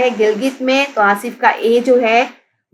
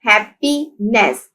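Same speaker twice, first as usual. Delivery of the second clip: Happiness slowly split as hap, pee, ness; syllable by syllable; slower than usual. Shown as a demonstration. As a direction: separate each syllable of happiness. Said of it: "happiness के three syllables हैं".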